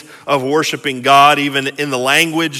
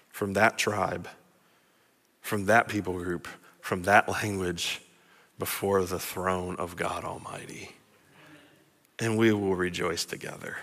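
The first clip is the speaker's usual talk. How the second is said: low, soft promise with long pauses; hushed preaching; 7 semitones lower, 13 dB quieter than usual. Real, not acted. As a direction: reassure them quietly, phrase by phrase. Preacher drops to reverent hush.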